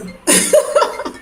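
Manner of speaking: high, breath-heavy giggling burst; high laugh. Real, not acted. Laughs after saying the name.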